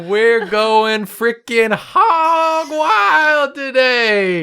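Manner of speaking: slow high hollering cowboy; hog wild holler. Acted. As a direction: drawl it out like a cowboy.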